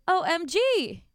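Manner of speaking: loud, high, drawn-out OMG; appalled exclamation. Real, not acted. Host aghast at the boyfriend's behavior, appalled exclamation. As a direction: exclaim it in dramatic disbelief.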